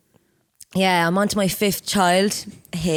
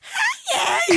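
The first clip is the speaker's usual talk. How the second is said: much higher, squeaky mock greeting with a laugh. Teasing her co-host by copying her squeaky greeting. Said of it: "You sound real squeaky".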